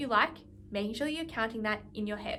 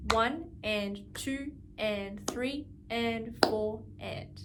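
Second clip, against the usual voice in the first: slow steady counting; much slower than usual. Shown as a demonstration. Teacher counting a bar slowly for students, steady and even. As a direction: count each beat very slowly and evenly. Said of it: "it'll go like this".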